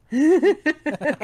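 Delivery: amused laugh at a stumble; laughing at own slip. Real, not acted. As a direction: break into a real laugh. Reader stumbles, laughs at self.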